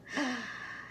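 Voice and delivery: a quick, high gasp of delight; excited gasp. Acted. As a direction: catch your breath in surprise. Mouse's heart pounding with excitement.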